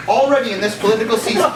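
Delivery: normal talking voice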